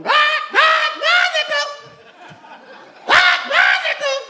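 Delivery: high, loud parrot squawk. Acted. Comedian mimicking the girlfriend's parrot, squawking parrot. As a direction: screech it out like a parrot.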